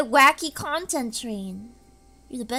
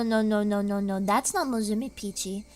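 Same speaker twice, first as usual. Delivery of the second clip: ordinary talk, nothing marked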